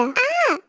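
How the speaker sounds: sharp high gasp of fright; startled gasp. Acted. Tae gasps as a branch crashes before the car, startled. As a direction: gasp as the branch falls in front.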